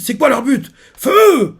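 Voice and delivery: high shouted command, ending in a yell; panicked order to fire. Retold or imitated. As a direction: go from alarmed question to screaming "Feu". The marines open fire. (ordre de tir crié, aigu et paniqué)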